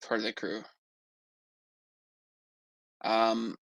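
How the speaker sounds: ordinary talk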